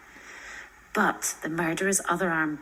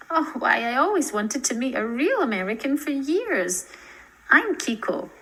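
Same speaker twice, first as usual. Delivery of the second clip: put-on eager, high girl's voice; light up at meeting an American; beaming welcome; 7 semitones higher, 4 dB louder than usual. A narrator's reading. Kiko greeting Lizzie, eagerly beaming. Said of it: "The stranger beamed".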